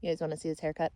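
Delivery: ordinary talk, nothing marked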